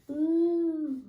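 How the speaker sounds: high, loud squeal of alarm; startled ooh. A narrator's reading. Piglet lurching upward in Kanga's pocket, squealing high and loud.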